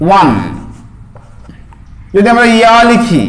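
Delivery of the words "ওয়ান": ওয়ান said as WAAN with clear final n; modelling for students; sounded out. Shown as a demonstration. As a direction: pronounce ওয়ান as one syllable ending in n. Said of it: "ওয়াও দু জাবার".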